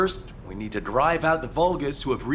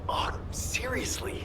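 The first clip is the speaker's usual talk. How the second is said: exasperated mutter; 10 semitones higher, 6 dB quieter, slower than usual. Acted. Exasperated aside after Bunny runs.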